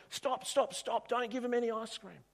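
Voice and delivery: high, desperate pleading yell; jealous boy still yelling at the shop owner; screaming. Acted. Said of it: "He's screaming at the shop owner".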